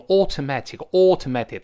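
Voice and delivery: British AUTOMATIC with rounded "aw" and clear t; British pronunciation. Shown as a demonstration. Teacher showing how the British say it, British rounded vowel. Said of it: "英国人又会读成automatic".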